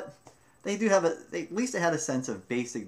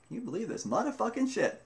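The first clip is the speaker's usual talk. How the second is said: amused disbelief; 11 semitones higher than usual. Retold or imitated. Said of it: "listening to all this going".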